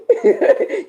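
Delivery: a high, loud burst of laughter. Real, not acted. Host cracking up at a viewer's joke, loud and high. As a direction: crack up loudly.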